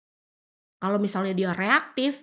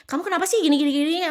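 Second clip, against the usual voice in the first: accusing complaint; 8 semitones higher, 5 dB louder, much faster than usual. Retold or imitated. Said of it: "Kalau kamu kan".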